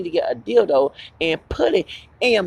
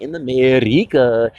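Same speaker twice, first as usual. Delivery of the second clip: mock Puerto Rican accent; 4 semitones lower, 5 dB louder, slower than usual. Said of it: "West Side Story".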